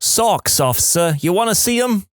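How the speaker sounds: put-on a deliberately phony Jersey accent, cheeky; fake Jersey accent. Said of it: "In my fakest Jersey accent".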